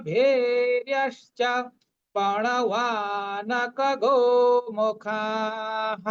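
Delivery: slow, measured sanskrit chant; full verse recitation. Shown as a demonstration. Teacher reads the full shloka for students, slow chant. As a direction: intone the whole shloka evenly. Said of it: "পূর্ণ শ্লোকটা পড়ছি".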